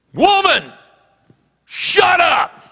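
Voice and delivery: high, loud retold yell; commanding shout. Bible college president silencing a woman, shouting. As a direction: shout her down in two bursts.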